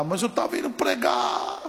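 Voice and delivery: imitated strained, wailing complaint; struggling protest. Injured pastor pleading his case to God, in strained protest. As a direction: argue back through pain, stretching the last word. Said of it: "E aí eu comecei a lutar".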